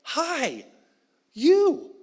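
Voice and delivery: high, loud delighted hi; excited greeting. Retold or imitated. David thrilled to see Mephibosheth, excited. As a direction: greet him as if overjoyed. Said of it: "this excitement of like".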